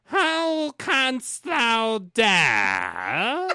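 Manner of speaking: very high, slow, loud mock witch voice; enchantress. The enchantress catches the husband in her garden, high hammed-up witch cry. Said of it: "I guess I'll be the enchantress".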